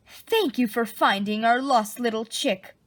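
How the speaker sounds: normal talking voice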